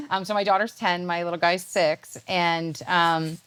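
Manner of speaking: usual speaking voice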